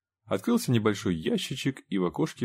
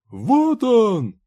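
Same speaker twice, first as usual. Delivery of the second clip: high, loud comic-book yell; piercing scream; 9 dB louder than usual. A narrator's reading. Talking comic book announcing a sighting, piercing scream. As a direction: cry out at the top of the voice. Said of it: "раздался душераздирающий вопль".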